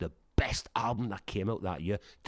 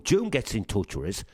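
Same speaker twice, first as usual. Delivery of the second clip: ordinary talk, nothing marked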